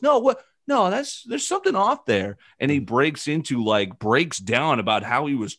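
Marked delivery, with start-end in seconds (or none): 0.0-2.4 Tyson quote. Retold or imitated. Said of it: "He goes".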